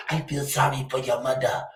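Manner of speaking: mock Chinese-accented English, quiet and clipped. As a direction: imitate a Chinese man's accent, soft. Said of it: "With the accent".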